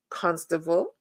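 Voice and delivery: usual speaking voice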